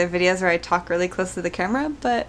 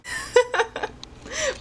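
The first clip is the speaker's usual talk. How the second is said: high breathy giggle. Real, not acted. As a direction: giggle at your own joke. Laughs at own joke.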